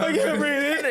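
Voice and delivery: high, loud hammed-up sob through laughter; mock crying gasp. Retelling the camp, the boy mock-sobs he can't breathe. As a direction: play the panicked kid, half laughing. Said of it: "I'm, like, crying".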